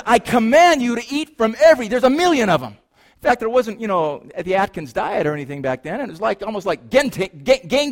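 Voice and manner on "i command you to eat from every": excited God. Acted. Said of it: "God says".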